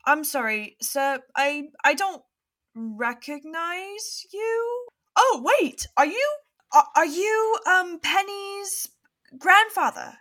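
high, loud, halting character voice; hesitant professor. Acted. Professor Abbott unsure she recognizes Penny's grandfather, hesitant. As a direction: pitch it up and stumble towards recognition. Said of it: "Professor Abbott is like".

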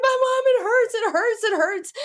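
high-pitched whiny wail; mock tearful, insistent whine; crying child. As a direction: imitate a kid crying to mom. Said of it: "she's crying and crying".

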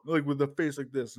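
ordinary delivery

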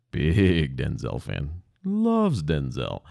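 "Big" and "Loves" stretched way out; slow right down, leaning long on "Big" and "Loves"; drawn-out emphasis. Real, not acted. Describing a superfan coworker, "Big" and "Loves" drawn out for emphasis. Stressing a coworker's extreme fandom.

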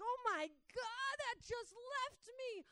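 very high, loud, drawn-out cry; imitated elated scream, stretched out; ecstatic amazement. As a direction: act out overwhelmed relief in a slow shout. Acting out woman's ecstatic relief.

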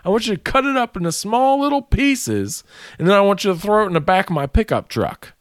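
high, pushy mock manager; mock boss voice. Coworker mocking his boss's demand, high and pushy. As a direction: boss bossing him around, pitched high.

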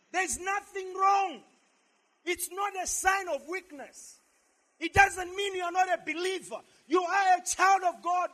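high, loud, insistent preaching. Real, not acted. Pastor assures believers crying is no weakness, forceful preaching. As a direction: declare it with full conviction.

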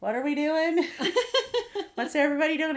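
put-on curious teen, laughing. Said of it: "fifteen-year-old's like".

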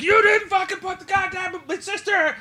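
high, slow, strained mock rant; mock outburst. Brother confronting the reporter, high mock rage. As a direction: act out the furious brother.

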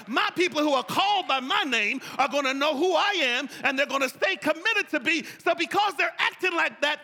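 loud, high, preaching God's complaint to Moses; God pushing the people off on Moses, loud and high; voicing God, preached. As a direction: belt it out as an exasperated God. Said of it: "He's gonna say".

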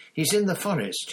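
high, loud urgent repeat. A narrator's reading. Harry shouting the news again at Snape. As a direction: shout it so he finally listens. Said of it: "Harry shouted".

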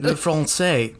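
French said in a light Southern drawl; French with a Southern twang. Pretend. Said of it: "Southern there".

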